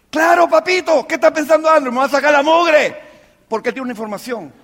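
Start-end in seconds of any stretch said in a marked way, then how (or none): 0.1-3.0 higher, heated acted reaction; incredulous mock outburst. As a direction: blurt it out like an alarmed volunteer. Voicing the volunteer's imagined reaction. (exclamación exagerada e incrédula)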